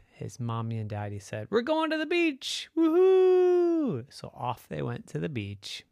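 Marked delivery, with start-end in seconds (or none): 1.5-4.1 high-pitched, jubilant announcement ending in a whoop; thrilled parent's whoop; excited cheer. Acted. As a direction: cheer it out for the kids, high and loud. Parents announce a beach trip.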